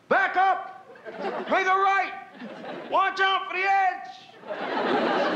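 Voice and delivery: high shouted commands, last one stretched out; goofy yelling. Acted. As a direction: yell silly orders at the crew. [name] shouting orders while goofing.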